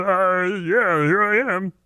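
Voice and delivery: high Kermit-like stoner voice; lightly voiced puppet offering a smoke; Kermit-Ray blend. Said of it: "the Kermit Ray".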